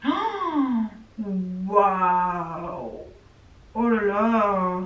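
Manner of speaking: soft, slow gasp of wonder; awed gasp. Real, not acted. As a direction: breathe out amazed, drawn out. First look at new palette.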